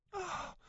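the breath of a bird finding its nest empty; soft stricken oh; dismayed gasp. Acted. Catherine finds drawer empty.

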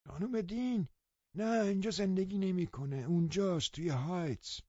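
put-on an old housekeeper, high and thin; old woman's voice. A narrator's reading. Old woman answers Lockwood. (صدای زیر و آرام پیرزن)

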